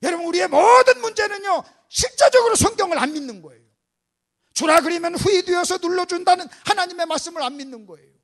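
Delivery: very loud, emphatic declaration; booming pulpit proclamation; full-force preaching. Real, not acted. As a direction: drive the point home to the congregation. Preacher declares main point forcefully.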